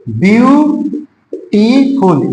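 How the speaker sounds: "Beautiful" very slow, first syllable held long, then a clear pause. Shown as a demonstration. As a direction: sound out "beautiful" in separated, stretched syllables. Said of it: "जब हम pronunciation करते हैं तो अधिक जाता है".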